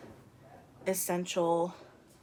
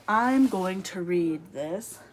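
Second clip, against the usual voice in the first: determined vow; 8 dB louder, slower than usual. Retold or imitated.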